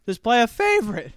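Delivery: emphatic. Shown as a demonstration. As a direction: punch it out as the punchline. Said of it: "Just, but just play a favorite".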